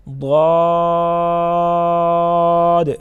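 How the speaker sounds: very slow, loud, heavy ضاد; thick ضاد. Shown as a demonstration. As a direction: stretch ضاد out with a full, thick sound. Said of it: "ضاد کو موٹا پڑھیں گے". (موٹا اور لمبا ضاد)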